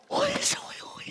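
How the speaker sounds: breathy hushed gasp of wonder; whispered amazement. Acted. Wife whispers over the found money, hushed awe. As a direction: whisper it in disbelief.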